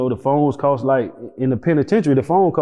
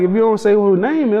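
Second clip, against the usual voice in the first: higher, agitated; 8 semitones higher, 3 dB louder, faster than usual. Retold or imitated. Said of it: "I'm like".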